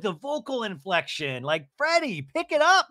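ordinary talk

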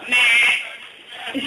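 imitated sheep's baa; sheep bleat. Comic giving the supposed common Welsh phrase, sheep bleat. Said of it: "the most common phrase around there".

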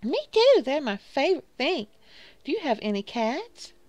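high, loud, gushing excitement; eager cat lady. Acted. Cat lady delighted by a fellow cat lover, high and gushing.

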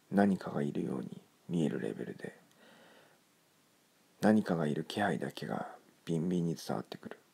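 ordinary delivery

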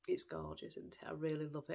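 ordinary talk

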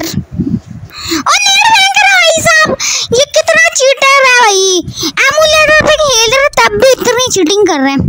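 high indignant gamer shout; panicking gamer. Real, not acted. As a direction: yell at a cheating opponent. Angry at cheating opponent.

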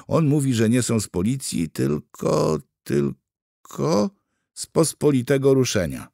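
struggling interpreter. A narrator's reading. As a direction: stretch "tylko" while hunting for the translation. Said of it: "Kobieta dawała z siebie wszystko". (tłumaczka z trudem szuka słów)

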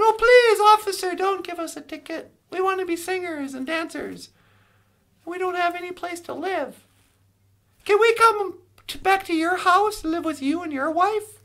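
high-pitched begging, loud at first; acted pleading. Three riders begging a policeman not to ticket them, pleading.

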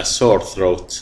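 ordinary talk

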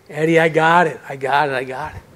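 gentle reassurance. Retold or imitated. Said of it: "patiently, lovingly".

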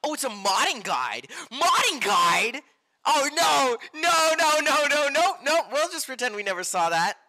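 high shouted alarm with a rapid run of no's; loud panic. Real, not acted. Streamer stumbling on a modding guide, loud panic. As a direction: shriek in dismay, then hurry to brush it off.

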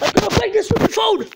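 high, loud tantrum yell; loud, high-pitched exasperation; furious threat. Real, not acted. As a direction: yell in rage at the phone. Threatens broken phone.

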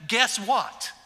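bursting out loud with news; excited news. Pretend. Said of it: "and he said".

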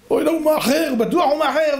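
high-pitched worried fretting; acted panic. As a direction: fret aloud as if running late. Friend racing against the deadline. (דאגה מבוהלת בקול גבוה)

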